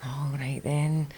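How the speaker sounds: slow, soft resigned sigh of a line; giving in. Retold or imitated. Mum giving in to the kids, slow and soft. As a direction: cave in slowly.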